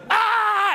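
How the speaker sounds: high-pitched imitated crowd howl; long high mock laughing cry; mock hysterical laugh. Business group cracking up at him, imitated hysterics. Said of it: "they just keep laughing".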